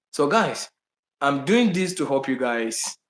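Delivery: ordinary talk, nothing marked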